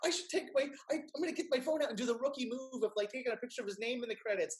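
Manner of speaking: high-pitched and loud, gushing fangirl squeal; the friend's name in the credits, gushed out giddy; giddy excitement. Retold or imitated. Alone at the movie, squealing high about best friend. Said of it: "and I'm just like".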